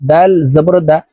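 usual speaking voice

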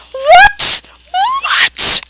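very high squeaky question; mock disbelief. Repeated disbelief at the answer.

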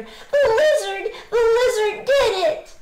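high, loud, elated exclamation; joyful cry. A narrator's reading. Alexander tells Willie of the magic, elated cry. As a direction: burst out with thrilled amazement. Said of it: "cried Alexander".

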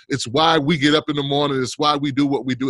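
usual speaking voice

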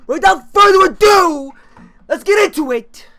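high, loud, slow hammed-up announcer shout; announcer intro. To his viewers before the gameplay, a slow hammed-up announcer shout. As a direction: boom it out like a showman opening the show. Mock-grand intro to the video.

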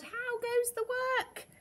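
very high, drawn-out farmer's call. A narrator's reading. Farmer woken by rocking bed, high slow call. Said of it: "he called".

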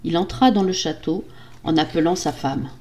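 ordinary delivery, nothing marked

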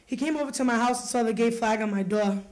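ordinary delivery

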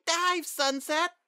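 very high, drawn-out call; slow, squeaky urgent cry; distant child's scream. A narrator's reading. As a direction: scream it from far down the field. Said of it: "a distant voice screamed".

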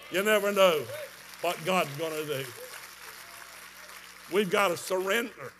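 usual speaking voice

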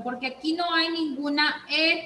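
ordinary delivery

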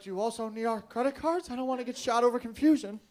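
earnest questioning. Retold or imitated. Comic to a robber about credit cards, earnestly questioning. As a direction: raise the pitch, plead confusion politely.

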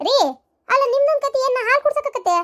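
high-pitched exasperated retort; exasperated scoff. Acted. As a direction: scoff at a husband's silly idea. Wife scoffs at husband's suggestion. (ಬೇಸರದಿಂದ ಎತ್ತರದ ದನಿಯಲ್ಲಿ)

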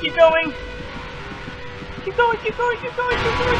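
shrill, loud, panicked repeating; desperate high-pitched urging; frantic high urging. Real, not acted. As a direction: push your friend on in real terror. Urging friend while chased.

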